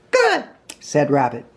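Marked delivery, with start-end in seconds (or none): 0.1-0.4 very high character voice. A narrator's reading. Rabbit answering his guest Pooh, very high voice. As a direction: pitch it way up, small and proper.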